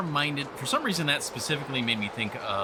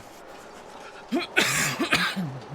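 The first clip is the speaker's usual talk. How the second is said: coughing; 5 semitones higher than usual. Real, not acted. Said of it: "excuse me".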